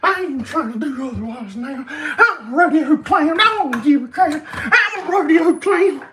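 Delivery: very high, loud squeaky clown voice breaking into laughs; giggling falsetto clown shouting; high-pitched rodeo clown. Pretend. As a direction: ham up a shrieking rodeo clown. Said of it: "high-pitched Ronald McDonald who sounds like this".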